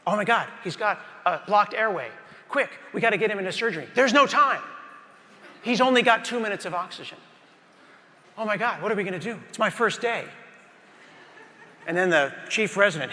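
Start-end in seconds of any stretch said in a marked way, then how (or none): 0.1-10.3 higher, fast, frantic rookie panic; panicked new nurse. Acted. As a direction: rattle it off like a terrified first-day nurse.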